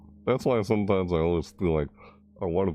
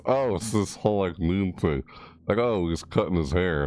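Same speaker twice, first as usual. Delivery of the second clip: ordinary delivery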